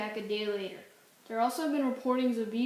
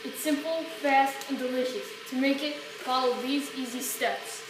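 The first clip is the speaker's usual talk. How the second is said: ad read; 7 dB louder than usual. Acted.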